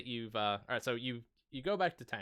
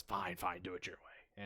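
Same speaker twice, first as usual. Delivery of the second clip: flustered giving up; 3 semitones lower, 8 dB quieter than usual. Acted. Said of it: "looks flustered at this point".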